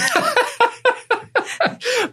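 high-pitched burst of laughter. Retold or imitated. Guest laughing at the memory of the concert. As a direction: laugh it off, amused. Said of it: "I was like".

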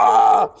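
brief scared shriek; mock scream. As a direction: yelp like on takeoff.

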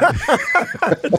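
a real high laugh; high burst of laughter. Real, not acted. Laugh after joke.

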